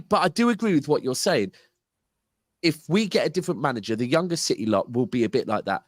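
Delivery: ordinary talk, nothing marked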